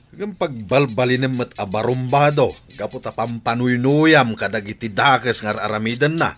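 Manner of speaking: husband accuses wife of spoiling their son, loud and angry; angry rebuke. Acted. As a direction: blame her for spoiling the boy. Husband blames wife's spoiling.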